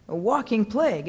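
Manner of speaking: ordinary delivery, nothing marked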